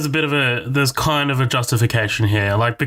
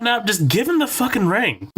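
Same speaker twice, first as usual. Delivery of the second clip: exasperated teammate; 7 semitones higher than usual. Retold or imitated. Exasperated mock teammate line.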